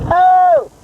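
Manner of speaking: sudden high startled cry; surprised yelp. Real, not acted. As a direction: blurt it out, caught off guard. Sudden exclamation on camera.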